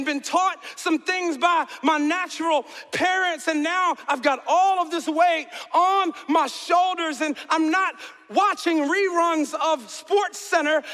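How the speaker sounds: slow, loud, high shouted preaching. Real, not acted. Pastor shouting his burden to the church, slow and loud. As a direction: hammer each word out slowly.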